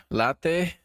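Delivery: Latte, open Spanish vowels, LAH-teh. Shown as a demonstration. Explaining how Spain reads the word, Spanish pronunciation. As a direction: say Latte just as written in Spanish. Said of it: "here in Spain, you read that the same way".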